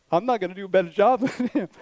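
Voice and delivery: quick retold climb-down, breaking into laughter; self-mocking, laughing through the words; laughing retreat. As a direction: laugh at yourself while retelling how you backed down. Humbled retelling, laughing at himself.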